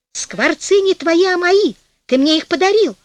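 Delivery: высокий настойчивый голос жены; отстаивай своё, повысив голос; возмущение жены. A narrator's reading. Жена вцепилась в клетку, спорит. (возмущённо)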